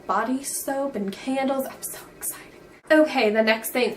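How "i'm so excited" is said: breathy excited whisper. Real, not acted. Vlogger whispering her excitement to viewers. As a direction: whisper it like a little secret. Whispered aside after normal line.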